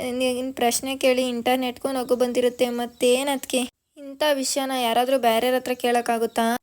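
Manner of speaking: ordinary delivery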